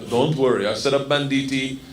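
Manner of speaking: put-on low, reassuring Russian accent; Igor. Gangster promising a friend protection on the train, low Russian accent. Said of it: "And he goes".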